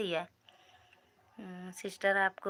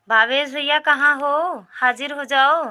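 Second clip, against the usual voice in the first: loud, high call to a missing viewer; 6 semitones higher, 10 dB louder than usual. Real, not acted. Streamer calling Bhavesh to come back, loud high call. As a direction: summon him loudly across the room.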